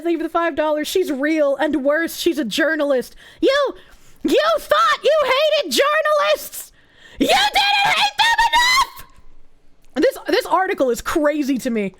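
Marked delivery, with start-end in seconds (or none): 3.4-9.0 incredulous shout. Real, not acted. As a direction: shout it, half laughing. Incredulous outburst about journalists.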